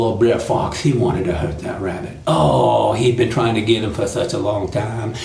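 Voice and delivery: ordinary delivery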